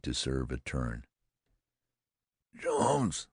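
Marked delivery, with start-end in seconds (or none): 2.6-3.3 high, strained call; alarmed hoarse call; hoarse cry. A narrator's reading. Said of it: "I cried hoarsely".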